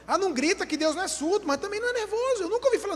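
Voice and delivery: high-pitched, quick mock protest; acted-out retort, pitch climbing high; mock bickering. Acting out an argument about shouting.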